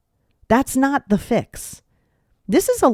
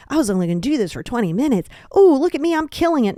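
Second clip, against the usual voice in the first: giddy overworker; 5 semitones higher than usual. Retold or imitated. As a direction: hype yourself up mid-binge. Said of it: "Don't sit there and be like".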